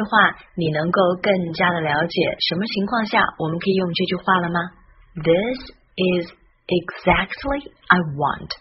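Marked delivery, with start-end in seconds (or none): 5.1-8.5 slow, with a pause after each word; word-by-word model. Shown as a demonstration. Teacher closing the lesson with the key sentence, slow and separated. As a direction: separate the words carefully for learners.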